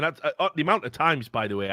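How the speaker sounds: ordinary delivery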